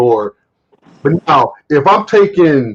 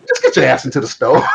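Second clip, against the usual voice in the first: telling her off through a laugh; amused mock command; laughing retort; 2 semitones lower than usual. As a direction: laugh while you snap back. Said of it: "I'm like".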